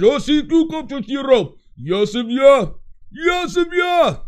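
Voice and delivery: loud, high mock-Russian outburst; bad Russian accent. Host imitating Drago for a caller, hammed-up shouting Russian accent. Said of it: "this is a bad Russian accent".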